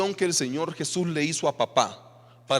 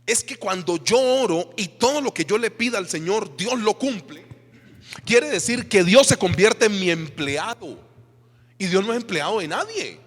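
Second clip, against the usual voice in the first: higher, louder, slowing on key words; fiery preaching; 6 semitones higher, 5 dB louder than usual. Real, not acted. Pastor to his church, insisting God is no employee, fiery preaching. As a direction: build to a preaching climax.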